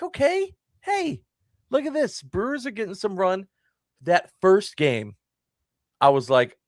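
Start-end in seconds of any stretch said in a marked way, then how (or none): 0.0-3.5 high-pitched, pleasantly surprised reaction; excited. Retold or imitated. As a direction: start high and perk up at good news, easing down. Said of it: "I was excited".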